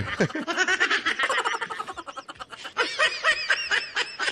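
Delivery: long giggling high laugh. Real, not acted. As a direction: laugh hard at the joke. Long laugh at own joke. (mahabang tawa)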